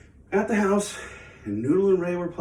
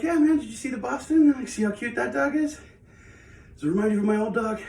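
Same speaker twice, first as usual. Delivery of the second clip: excited; 7 semitones higher than usual. Retold or imitated. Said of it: "I was like".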